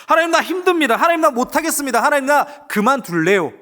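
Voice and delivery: high, loud, whining plea to God; complaining prayer. Acted. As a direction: complain to God that you want to quit. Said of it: "라고 질문해야 됩니다".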